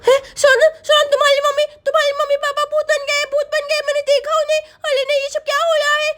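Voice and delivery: put-on high, quick lisping child; alarmed little Chinki. Girl tells friend her parents became ghosts, alarmed child voice. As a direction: blurt it in a panicky little-girl voice. Plays Chinki breathless with news.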